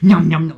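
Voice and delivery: loud, high munching "nom nom"; mock eating noises. Gamer gulping health potions, loud munching. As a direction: gobble it up greedily out loud. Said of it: "we'd be like".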